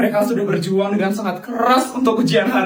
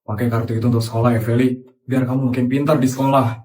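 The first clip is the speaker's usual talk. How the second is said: lower, deep-set voice of a generous rich uncle; 8 semitones lower, faster than usual. Acted. Uncle tells Feli he'll pay her school fees, low benefactor voice. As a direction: talk down gently to the girl as her benefactor uncle.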